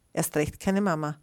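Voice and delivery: plain reading voice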